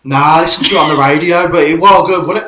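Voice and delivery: high, loud mock caller's answer; hammed-up football fan on the phone; radio phone-in caller. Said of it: "you get someone ringing up and go".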